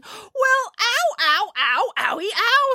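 high, hammed-up ows; pained yelping. A narrator's reading. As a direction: yelp repeatedly as if just stung. Frog reacts to being stung.

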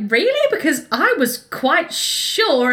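put-on a child puzzled by the grown-ups' reaction; surprised little boy. George asking innocently at the table, high and puzzled. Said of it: "And George is like".

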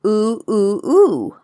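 "oo" sounded singly, three times over; demonstrating a thin "oo" noise; thinned-out O noise. Shown as a demonstration. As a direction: let "oo" run out as a thin round vowel. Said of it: "the O noise runs out all thin".

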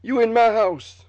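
loud, high, slow quoted threat; movie character voice. Retold or imitated. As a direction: shout it like an action hero. Quoting movie scene line.

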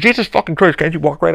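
loud, exasperated yell at a stranger; angry man yelling at someone on crutches; retold yell. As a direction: bark it out loud like an angry passer-by.

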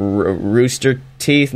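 usual speaking voice

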